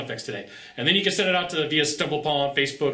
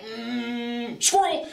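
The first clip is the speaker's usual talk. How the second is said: distracted buyer; 8 semitones higher, much slower than usual. Sales coach mimicking nervous buyer changing subject, high squeal. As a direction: play a nervous buyer dodging the question. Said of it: "So they're like".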